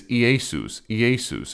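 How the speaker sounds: Iesus said as ee-YAY-soos, twice; Greek pronunciation. Shown as a demonstration. Showing where the English name comes from, Iesus as ee-YAY-soos. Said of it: "the Greek way of saying it".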